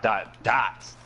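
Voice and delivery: "dot, dots" hit hard and separately; emphasis on DOT. Retold or imitated. Friend explains how to post it, "dot" emphasized. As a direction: punch each "dot" as its own beat. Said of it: "you have to emphasize on the dot".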